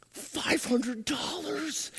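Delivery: high, slow, soft wonder; awed child's guess. Retold or imitated. Grandkid guessing the check amount, drawn-out awe.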